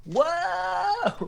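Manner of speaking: shrill squealing fangirl screams; excited anime fan. Pretend. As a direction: squeal like a starstruck fan meeting an idol. Fangirling over celebrity at con.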